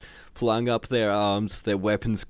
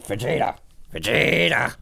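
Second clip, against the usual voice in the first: rough brute calling out, rising higher and louder; Nappa; 4 semitones higher, 5 dB louder than usual. Acted. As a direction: call a name in a cartoon brute's voice. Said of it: "This is my Nappa voice".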